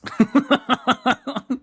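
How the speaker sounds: high burst of helpless laughter; cracking up hard; hysterical laugh. Real, not acted. Laughing at shit flakes.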